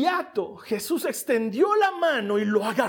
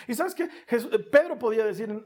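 ordinary delivery

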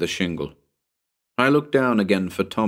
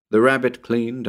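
ordinary delivery, nothing marked

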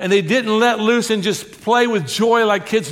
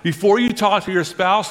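usual speaking voice

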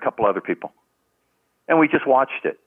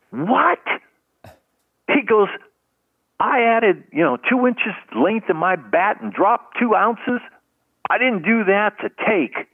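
indignant outburst; 12 semitones higher, slower than usual. Retold or imitated. Baseball star objects to the manager about taking pitches, indignant. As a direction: protest as if insulted by the order. Winfield objects, jumping from chair.

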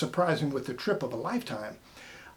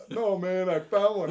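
lightly voiced, higher, laid-back drifter; mock hitchhiker voice; 5 semitones higher, 4 dB louder than usual. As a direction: repeat it like the amused beach hitchhiker. Repeating hitchhiker's line for laughs.

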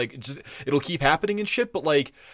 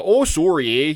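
exaggerated Canadian "sorry, eh"; 4 dB louder than usual. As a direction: put on a South Park-style Canadian accent. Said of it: "that's the way that Americans think Canadians talk".

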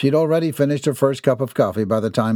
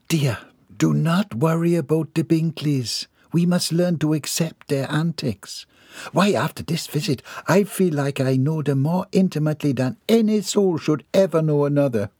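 higher, prim Southern lady; ladylike voice; 6 semitones higher than usual. Acted. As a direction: speak as a proper, composed matron.

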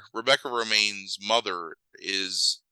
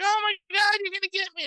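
put-on much higher, a little girl squealing in play. Little sister squealing at her brother in the pool, much higher. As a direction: pitch way up like a delighted kid. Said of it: "she's like".

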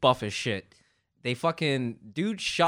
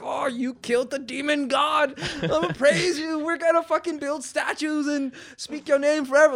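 mock praising villagers; 11 semitones higher than usual. Villagers praising the snake killers, hammed-up and high. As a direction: gush in a mock-reverent crowd voice. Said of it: "it's like".

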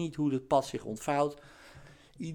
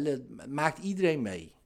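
ordinary talk

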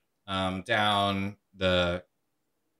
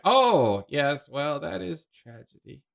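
higher, chirpy meddling ghost voice; pry kindly like a busybody aunt; nosy ghost; 7 semitones higher, 4 dB louder than usual. Acted. Ghost consoling the paladin, high and chirpy.

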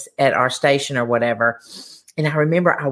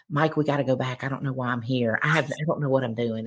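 panicked hushed plea; 5 semitones lower, 7 dB quieter than usual. Retold or imitated. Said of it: "I'm like".

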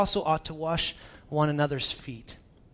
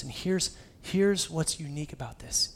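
ordinary talk

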